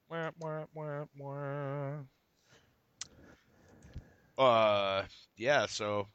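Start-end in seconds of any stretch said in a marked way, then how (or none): 0.1-4.4 sung-out sad trombone "wah wah"; comic failure-horn noise by mouth; mock sad trombone. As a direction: imitate a deflating trombone sting. Imitates sad trombone after joke.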